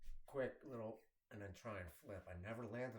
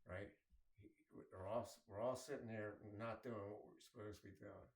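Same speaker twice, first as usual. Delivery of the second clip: normal talking voice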